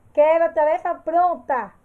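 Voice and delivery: loud, firm order-giving voice; commanding tone. Shown as a demonstration. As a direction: give the order loudly and firmly, like a demanding teacher. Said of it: "imperativo serve para dar ordens".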